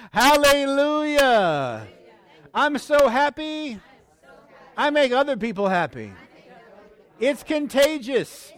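loud, high, drawn-out phrases for repeating; full-force preacher's call-and-repeat; leading prayer declaration. Real, not acted. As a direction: proclaim each phrase for the congregation to echo. Continues joyful led declaration.

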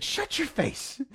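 high, slow and stunned; astonished exclamation. Retold or imitated. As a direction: gasp it out in disbelief. Stunned barber reacts to money.

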